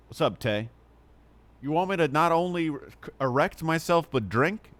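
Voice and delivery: normal talking voice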